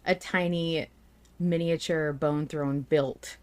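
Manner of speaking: normal talking voice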